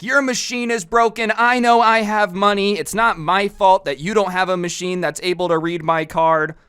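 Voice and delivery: hammed-up indignant complaint; mock defensive. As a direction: protest your innocence, pushing the blame away. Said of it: "starts getting insanely defensive".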